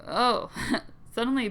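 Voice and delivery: usual speaking voice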